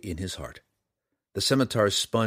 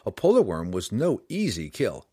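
ordinary delivery

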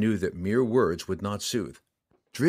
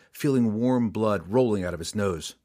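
ordinary delivery

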